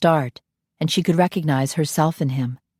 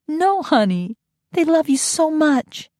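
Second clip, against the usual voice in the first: higher, slow tender voice; 11 semitones higher than usual. Acted. Nanny comforting the boy, tender. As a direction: say it lovingly.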